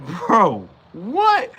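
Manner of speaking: high, loud disbelieving "what"; baffled Spike reacting to being shot at; incredulous bro. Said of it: "and it's like".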